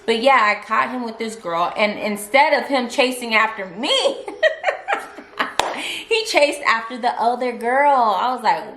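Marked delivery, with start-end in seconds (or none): 4.3-6.0 a high, airy, unvoiced laugh; breathy laugh. Real, not acted. Laughing to viewers about him chasing the other girl, airy laugh. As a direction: laugh in disbelief at the irony.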